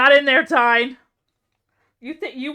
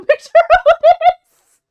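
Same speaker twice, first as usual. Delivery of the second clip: laughing; 11 dB louder, slower than usual. Real, not acted. Laughs at winning clip.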